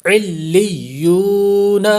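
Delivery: علیون recited slowly, ain joined to the doubled lam and ya, no ghunna; tajweed recitation. Shown as a demonstration. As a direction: join lam and ya in علیون without nasalizing. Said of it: "لام مشدد ہے اور یا مشدد ہے".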